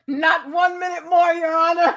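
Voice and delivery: high hammed-up plea; mock dramatic. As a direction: play the fainting juror to the judge. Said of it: "Can you hold on any longer?".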